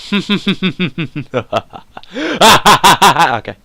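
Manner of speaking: mock villain laugh into okay; a playful evil chuckle; menacing laugh. Showing chat his menacing laugh, playful mock cackle. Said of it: "I can do a pretty menacing laugh".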